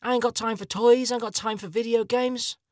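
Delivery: high-pitched mock plea; pleading young man. Twenty-something asks Japan for help, high mock-desperate plea. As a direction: appeal helplessly, pitched well up. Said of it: "you go to Japan and say".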